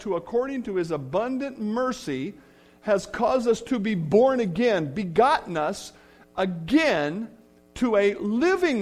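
ringing pulpit recitation; preached scripture. Acted. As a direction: proclaim the verse with building force. Declaims a scripture verse in sermon.

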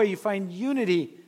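ordinary delivery

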